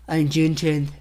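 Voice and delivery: lightly voiced Trump impression. Vlogger reading Trump's tweet aloud, light Trump impression. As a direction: read the tweet opening as Trump. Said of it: "I'm gonna read it in his voice".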